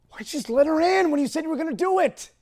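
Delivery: high, loud, despairing shout; anguished self-reproach. Retold or imitated. Owner berates himself for not letting her in, anguished yell. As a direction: cry out at yourself in blame. Scolding himself for leaving dog out.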